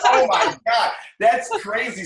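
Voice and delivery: high, loud laughing disbelief; retold shock. Host retelling her reaction to the stripper-pole case, laughing in disbelief. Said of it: "I was like".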